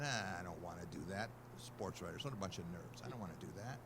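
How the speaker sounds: dismissive husband mumbling no; reluctant grumble. Retold or imitated. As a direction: brush off the idea with a groan. Reluctant husband refusing wife's idea.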